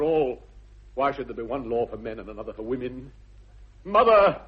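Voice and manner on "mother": high and loud, grandly declaimed; exultant forgiveness. Acted. Son forgiving Miss Prism, high and loud. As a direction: proclaim it with sweeping generosity.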